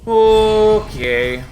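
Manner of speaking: high, loud drawn-out okay; exasperated okay. Real, not acted. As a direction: let the frustration out. Frustrated reset.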